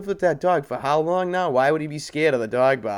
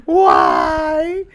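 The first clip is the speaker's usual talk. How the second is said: mock wailing; 5 dB louder, much slower than usual.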